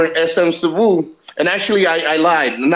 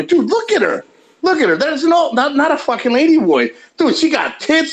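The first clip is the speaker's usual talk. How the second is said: excited disbelief; 9 semitones higher than usual. Retold or imitated. Insisting she's no lady boy.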